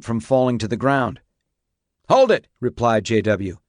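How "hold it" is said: high, loud command; urgent shout. A narrator's reading. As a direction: shout it sharply to the rider. Shouting orders at runaway horse's rider.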